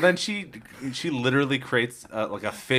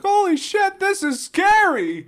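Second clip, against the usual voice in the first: panicked villagers; 7 dB louder, much slower than usual. Retold or imitated.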